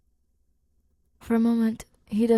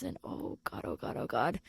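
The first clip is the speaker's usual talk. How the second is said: flustered inner panic; 7 semitones lower, 7 dB quieter than usual. Acted. Grace flustered by Simon's forearms.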